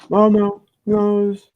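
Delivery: squeaky high no no no; high-pitched flustered denial. Retold or imitated.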